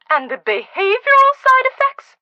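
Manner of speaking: high, loud indignant outburst; indignant exclamation. Acted. Scolding Nicholas about her cat, high indignant outburst. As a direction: fling the question up in outrage.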